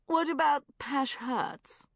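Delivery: slow, high sulky whine. A narrator's reading. Shaz sulking at the friends' game, slow and sulky. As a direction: sulk it out like a hurt friend. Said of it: "said Shaz sulkily".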